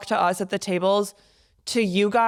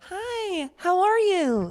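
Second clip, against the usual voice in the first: much higher, polite server greeting; customer service voice; 10 semitones higher, much slower than usual. Retold or imitated. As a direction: greet a table like a cheerful waitress. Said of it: "In my customer service voice".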